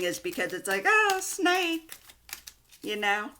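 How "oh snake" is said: high, teasing "Oh, snake"; suggestive mock "oh". Reader teasing viewers about the snake card, mock suggestive. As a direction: coo it with a wink. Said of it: "that's why I'm laughing".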